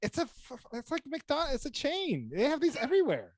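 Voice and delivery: imitating his own disbelief at a chain restaurant, pitched well up; exasperated retort. Retelling own exasperated reply.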